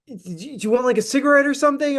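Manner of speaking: very high, fast, stammered start; crazy-sounding fan. Retold or imitated. Fan offering the singer a cigarette, crazy and rushed. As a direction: imitate a frantic guy rushing out an offer. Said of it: "I know I sound crazy".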